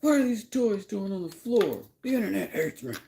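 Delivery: high, loud mock tirade; mock angry husband. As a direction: bark it like a cranky husband.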